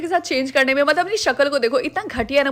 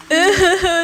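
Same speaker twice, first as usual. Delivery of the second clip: laugh; 2 semitones higher, 4 dB louder than usual. Acted. Gleeful laugh at a friend.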